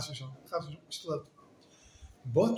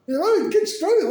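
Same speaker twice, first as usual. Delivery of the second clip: excited outburst; 14 semitones higher, 10 dB louder than usual. Real, not acted.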